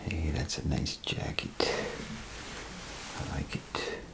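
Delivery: normal talking voice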